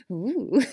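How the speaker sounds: high, loud burst of laughter; real laughter. Real, not acted. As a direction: laugh it off, self-conscious. Laughing at her own seriousness.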